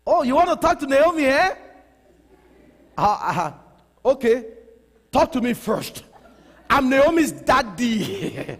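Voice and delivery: put-on light Nigerian accent, protective dad. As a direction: play the Nigerian dad grilling a suitor. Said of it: "My Nigerian accent".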